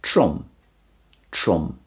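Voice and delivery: Trum with tapped-out short vowel, "trum"; Welsh pronunciation. Shown as a demonstration. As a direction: repeat Trum twice, short vowel and closed m. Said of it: "pronounced more or less the same in Welsh".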